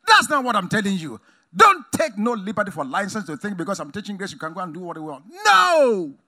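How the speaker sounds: loud, high preacher's yell; Paul yelling his answer at the church. Retold or imitated. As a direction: bellow the refusal. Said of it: "So he's yelling it".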